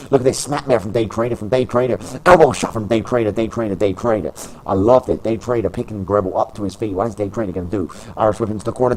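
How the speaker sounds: lively wrestling commentator calling the match; play-by-play commentary. Acted. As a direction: call every move as it lands.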